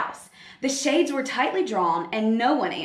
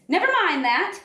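higher, louder sharp snap; snapping; 7 semitones higher, 4 dB louder than usual. Acted. Miss Swamp snapping at the class. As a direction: cut the kids off like a stern witch. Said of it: "snapped Miss Swamp".

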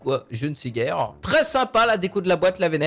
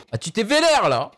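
normal talking voice

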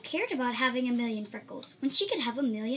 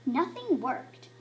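ordinary delivery

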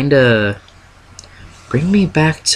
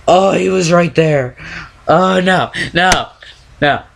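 frightened; 5 semitones higher than usual. Real, not acted. After escape, still frightened.

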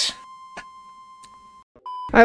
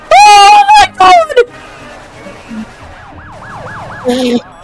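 loud, very high scream; full scream; 5 dB louder, slower than usual. Real, not acted. Gamer screams as gunshots ring out, terrified. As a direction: scream at the top of your lungs.

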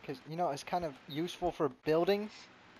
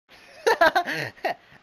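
sudden loud burst of laughter; big real laugh; 13 semitones higher, 13 dB louder than usual. Real, not acted. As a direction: laugh out loud at your own joke. Bursts out laughing at own joke.

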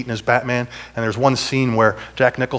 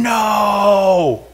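a louder, higher 'No!'; mock scream; 6 semitones higher, 3 dB louder than usual. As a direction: let the 'No!' burst out high. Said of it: "Everything in me is screaming".

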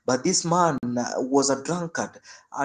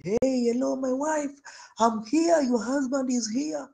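calling husband; 10 semitones higher than usual. Retold or imitated. Drunk husband calling his sleeping wife, high calling. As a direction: call through the door.

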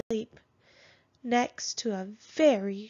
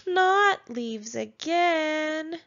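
higher, slow whiny moan; 6 semitones higher, 5 dB louder, much slower than usual.

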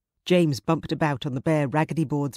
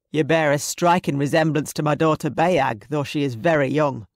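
louder, commanding Scottish raider; imposing clansman; 5 dB louder than usual. Acted. Raider studying the captive girl, imposing voice. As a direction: play the imposing villain sizing someone up.

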